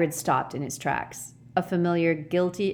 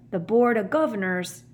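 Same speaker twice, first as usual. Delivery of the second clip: raised, full-voiced rustic giant; worried gentle giant; 5 semitones higher, 3 dB louder than usual. A narrator's reading. Hagrid airing fears before a ghost passes, voiced fully. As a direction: voice it fully, as if cut off.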